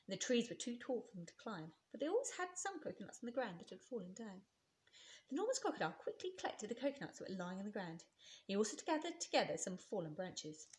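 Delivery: ordinary delivery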